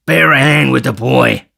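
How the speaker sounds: robber's impatient shout; furious cry. A narrator's reading. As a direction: holler for help from your confederate. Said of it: "cried Sikes, beckoning furiously".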